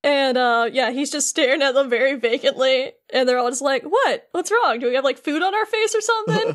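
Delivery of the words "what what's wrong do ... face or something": put-on higher-pitched quizzical kids; imitated puzzlement. Friends react to Sora's vacant stare, imitated high puzzlement. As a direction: ask in a raised, mock-puzzled voice.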